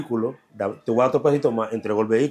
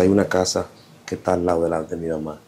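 ordinary talk, nothing marked

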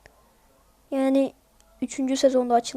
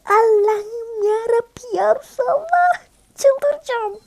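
desperate pleading; 9 semitones higher, slower than usual. Real, not acted. Losing the match, pleading.

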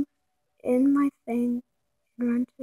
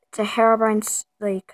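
normal talking voice

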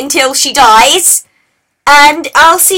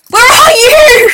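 high, loud, drawn-out yell; frustrated shout; 10 semitones higher, 6 dB louder, slower than usual. Real, not acted. Player hunting his opponent underwater, frustrated high shout. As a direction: holler it slowly across the distance.

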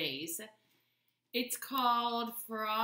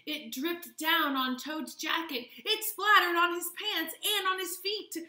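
narration climbing higher and louder; building excitement; 8 semitones higher, 6 dB louder than usual. A narrator's reading. Narrator tells children of ice cream splattering Toad, excitement building. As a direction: build the mishap, pitch and volume rising. Melting mess builds in story.

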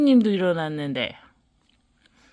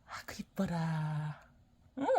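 whispering; 5 semitones lower, 14 dB quieter than usual. Real, not acted. Mother cooing at baby.

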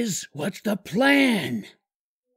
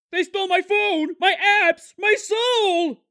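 high shouted lament; despairing wail; 10 semitones higher, slower than usual. Acted. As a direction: cry out as if everything is lost. Wailing over stolen phone.

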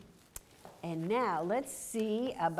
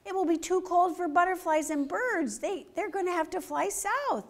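urgent fox; 8 semitones higher than usual. Acted. Fox warns squirrel.

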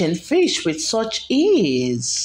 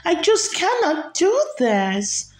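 higher, sad little-bird voice; a downcast flamingo complaining; sad flamingo Floss; 8 semitones higher than usual. Acted. Floss repeats her lament.

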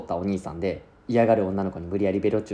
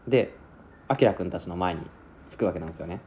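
normal talking voice

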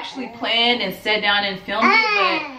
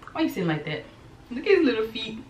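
overwhelmed by cuteness; 3 semitones higher, 4 dB quieter, faster than usual. Real, not acted. Cooing at the baby's feet.

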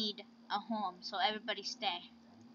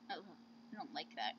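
ordinary talk

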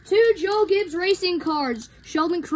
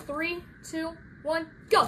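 ordinary talk

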